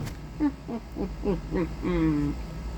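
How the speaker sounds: soft, slow evil chuckle; Grinch laughing. Retold or imitated. Grinch outside the window, quiet villain laugh. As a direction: chuckle low and menacing, like a villain. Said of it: "all I hear is".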